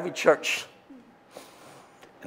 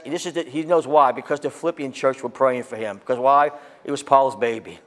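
usual speaking voice

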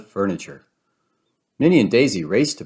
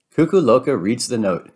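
usual speaking voice

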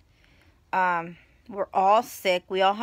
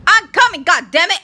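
loud, high shout toward the door; annoyed shout to sister knocking; 7 semitones higher, 12 dB louder than usual. Real, not acted. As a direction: yell across the apartment.

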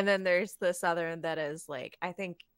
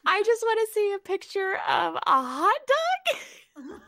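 high-pitched, loud cartoon Texan plea; high, loud mock-Southern whine; King of the Hill voice; 12 semitones higher, 6 dB louder than usual. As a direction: plead in a pushed-up cartoon voice. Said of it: "Talk King of the Hill to me".

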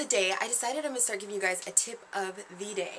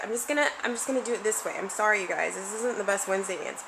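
usual speaking voice

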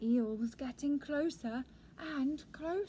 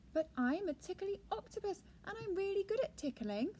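higher-voiced, mildly sad protest; sadly; 7 semitones higher than usual. A narrator's reading. Octopus defending his tickling, mildly sad. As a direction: plead it with a touch of hurt. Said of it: "said Octopus sadly".

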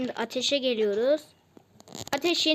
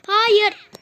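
high, loud burst of no; yelling at the failed jump; sharp shout; 6 semitones higher, 8 dB louder than usual. Real, not acted. Gamer yelling no at the failed jump. Character fails again.

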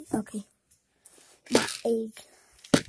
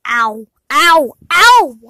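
rising ow getting louder; pained cries; 3 semitones higher, 16 dB louder, slower than usual. Acted. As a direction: cry out in growing pain. Getting hurt, building.

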